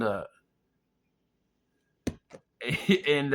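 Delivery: ordinary delivery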